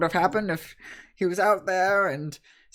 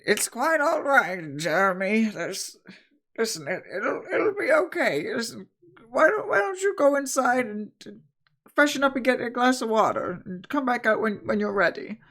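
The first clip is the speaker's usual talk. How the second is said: lightly voiced old woman, a bit higher; 8 semitones higher than usual. Old woman calming Jeremy, lightly voiced and soothing. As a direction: soothe him like a kindly grandmother, lightly voiced. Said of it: "She goes".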